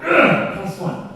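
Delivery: mock annoyed ugh, then a grudging plus one; retold groan. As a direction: groan like a fed-up student. Students groaning at new task.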